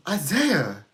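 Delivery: loud, high parental call of a name. Retold or imitated. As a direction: call out like a parent summoning the child. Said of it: "my parents would be like".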